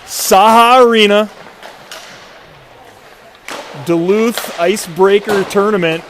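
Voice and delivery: slow, drawn-out game introduction; arena announcer. Acted. Broadcaster introducing the hockey matchup to viewers, slow announcer delivery. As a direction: stretch the names out like a rink announcer.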